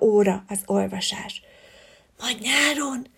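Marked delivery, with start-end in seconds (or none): 2.2-3.1 soft hushed reply; whispered. Acted. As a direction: murmur it behind the book. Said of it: "súgta oda Szarvas Szilvi".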